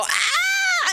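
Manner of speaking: very high, shrill shriek of fright; retold scream. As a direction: let out a piercing scream at the sight. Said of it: "and I scream".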